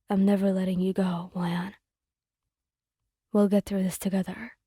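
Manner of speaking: low, hushed vow; determined vow. A narrator's reading. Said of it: "his voice filled with determination".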